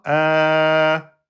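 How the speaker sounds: long open "a", just air passing; held a. Shown as a demonstration. Teacher showing the sound just flows out. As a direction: let the "a" flow out. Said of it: "Donc, le son est là".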